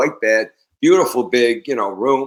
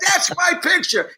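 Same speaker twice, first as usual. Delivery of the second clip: high mimicked protest; retold outraged claim; indignant outburst. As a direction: cry out in a strained high voice, acting offended. Acts [name]'s outrage over his picture.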